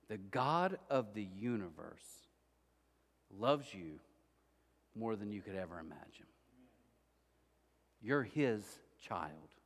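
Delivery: slow tender pastor's assurance; tender preaching. Real, not acted. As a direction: lay it down gently, slowly. Tender sermon close before prayer.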